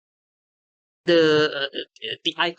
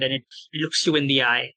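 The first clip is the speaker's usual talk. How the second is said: normal talking voice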